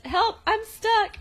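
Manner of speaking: high, drawn-out mock plea; mock cry for help. Imitating Matt stuck to the wall, mock helpless cry. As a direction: whine for help in a put-on high voice.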